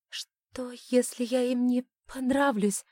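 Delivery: worried high voice that breaks; voice breaking. A narrator's reading. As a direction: let the voice crack with worry. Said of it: "Голос предательски сорвался".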